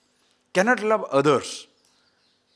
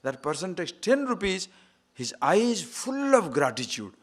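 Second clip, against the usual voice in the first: ordinary delivery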